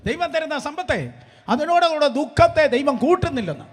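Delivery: loud, high preaching at full force; ringing pulpit proclamation; fiery preaching. Acted.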